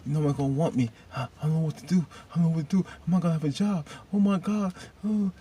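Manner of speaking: high-pitched mock panic; hammed-up worried whine; panicked acting. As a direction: act out fretting in a high, helpless voice. Acts out a character panicking over losing job.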